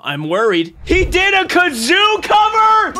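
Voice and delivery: usual speaking voice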